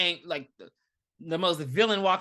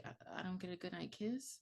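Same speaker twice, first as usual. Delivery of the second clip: softer, pouty little question; mock hurt question; 16 dB quieter than usual. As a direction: ask for the kiss, much softer, mock sulking. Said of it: "she's like".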